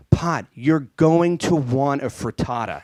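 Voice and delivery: loud, slow announcer tagline; hammed-up commercial sign-off; mock ad slogan. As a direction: stretch out the absurd punchline loudly.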